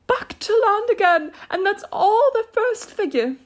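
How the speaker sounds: very high, loud creature voice, falling at the end; high excited turtle; Mock Turtle. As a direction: announce it high and loud, then drop. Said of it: "said the Mock Turtle".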